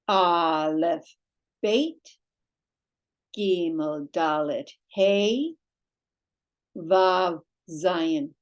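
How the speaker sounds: each letter named slowly with a pause after it; teacher drilling the Hebrew alphabet; slow alphabet recitation. Shown as a demonstration. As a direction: recite the letters clearly for students to repeat.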